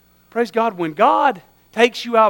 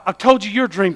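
normal talking voice

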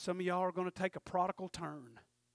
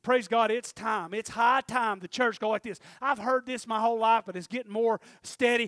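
louder, quickening preacher's call; preaching at full force; 5 semitones higher, 14 dB louder than usual. Acted. Preacher rousing the congregation, loud and fast at the end. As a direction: drive it home to the church.

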